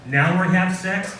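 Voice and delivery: usual speaking voice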